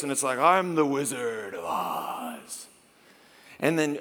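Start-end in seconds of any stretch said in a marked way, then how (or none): 0.4-2.7 slow, low-pitched mock wizard boom; lightly hammed-up booming wizard; a hint of the Wizard of Oz booming from behind the curtain. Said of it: "big booming voice".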